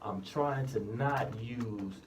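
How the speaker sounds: slow, careful radio delivery; speaking slowly. Real, not acted. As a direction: take each word slowly and deliberately. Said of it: "I may be speaking a bit more slowly".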